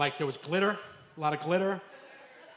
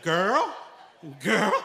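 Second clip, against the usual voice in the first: put-on sassy girl call; 3 semitones higher, 6 dB louder than usual. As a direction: imitate his sing-song girl. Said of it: "He'd be like".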